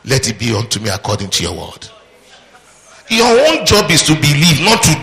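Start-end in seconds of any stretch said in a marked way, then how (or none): 0.1-1.9 humble acceptance. Retold or imitated. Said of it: "He say".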